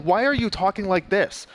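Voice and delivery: mocking imitation. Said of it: "I'm just gonna mock her".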